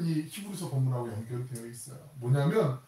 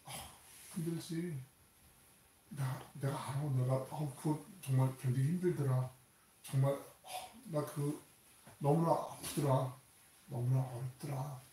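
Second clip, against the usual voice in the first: tender comfort; 5 dB quieter than usual. Acted. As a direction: comfort a suffering friend gently. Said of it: "야단치는 게 아니라, 핀잔을 주는 게 아니라".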